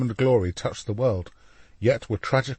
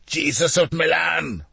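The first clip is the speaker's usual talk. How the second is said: slow, higher mad proclamation; screaming; 7 semitones higher, slower than usual. A narrator's reading. Alessandro proclaims himself with arms outstretched, screaming. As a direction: proclaim it like a madman. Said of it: "screamed Alessandro".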